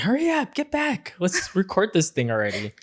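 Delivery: high-pitched mock excited urging; imitated impatient excitement. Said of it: "she started jumping".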